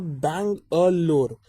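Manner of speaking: "Bang अ लोर" said slowly, each part apart; split into three. Shown as a demonstration. Teacher breaking the word into its three parts. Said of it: "अब उसको तोड़ोगे ना".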